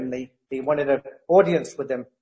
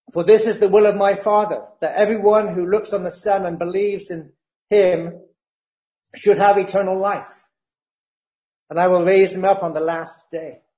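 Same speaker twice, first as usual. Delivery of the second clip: louder, proclaiming scripture voice; scripture reading; 3 semitones higher, 4 dB louder than usual. Acted. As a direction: proclaim the verse to the congregation. Reads scripture at full force.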